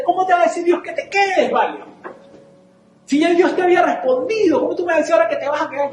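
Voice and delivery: a friend who can't believe what he hears; incredulous friend. Retold or imitated. Friend argues on phone.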